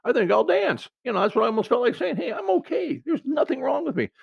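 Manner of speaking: a high, sing-song claim of being perfectly okay; Monty Python sketch voice. Retold or imitated. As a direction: squeak it out like the man who swears he's fine in Holy Grail. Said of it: "if you ever seen the movie Monty Python and the Holy Grail".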